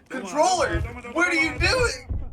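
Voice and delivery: high exasperated cry at the controller; real frustration, pitch way up; frustrated. Real, not acted. Dead controller mid game.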